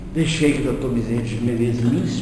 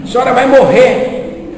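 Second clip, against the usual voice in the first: raised, higher and louder; loud declaration; 9 semitones higher, 11 dB louder than usual. Retold or imitated. Medium loudly repeats the warning to the lady, raised and emphatic. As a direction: proclaim it at her, pushing the word vai. Second louder try at telling her.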